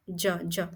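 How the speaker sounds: ज said alone twice, soft and low; phonic sound demo. Shown as a demonstration. Teacher isolating ज from जेंटल. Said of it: "जेंटल".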